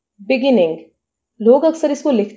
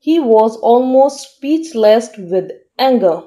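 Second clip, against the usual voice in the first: slow, careful dictation pace; teacher reading an example sentence very slowly; much slower than usual. Shown as a demonstration. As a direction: read it out slowly for learners to follow. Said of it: "sentence में इसको use करते हैं".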